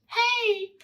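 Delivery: high tiny voice calling out; small bird's high little call. A narrator's reading. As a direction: squeak it like a tiny bird. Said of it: "said the little voice".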